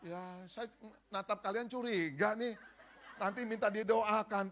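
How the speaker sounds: loud laughing aside; a preacher laughing at his congregation. Real, not acted. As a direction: chuckle as you tease the crowd.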